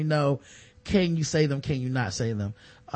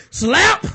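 higher, louder yelled slap; shouted slap sound; 10 semitones higher, 7 dB louder than usual. Pretend. Jim Brown slapping the trainee, yelled sound effect. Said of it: "slap the shit out of".

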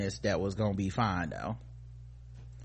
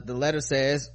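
ordinary talk

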